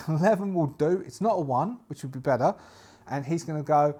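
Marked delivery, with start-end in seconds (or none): none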